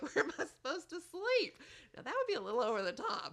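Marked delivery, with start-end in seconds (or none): 0.0-1.5 high, loud mock outrage; dismayed college kid. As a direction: exclaim it in comic disbelief, pitched way up. Acted kid finding bedroom gone.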